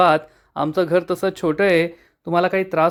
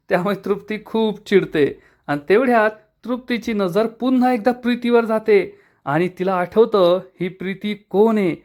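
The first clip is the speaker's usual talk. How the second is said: ordinary delivery, nothing marked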